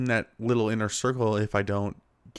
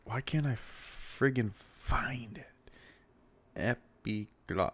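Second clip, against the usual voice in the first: slow whispered frustration; frustrated whisper; 6 dB quieter, slower than usual. Real, not acted. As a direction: whisper it, annoyed, slowly. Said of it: "searching, frustrated".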